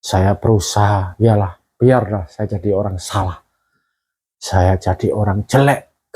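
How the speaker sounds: low, halting voice with long pauses; close to tears. Real, not acted. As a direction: hold back real tears while remembering. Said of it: "ini saya ceritain sampai kepingin nangis saya". (suara rendah menahan tangis)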